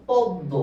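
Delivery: পদ্ম said as pod-do; silent ম. Shown as a demonstration. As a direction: drop the m in পদ্ম and double the d. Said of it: "ময়ের উচ্চারণ হচ্ছে না".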